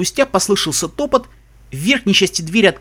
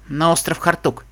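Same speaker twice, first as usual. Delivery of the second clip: ordinary delivery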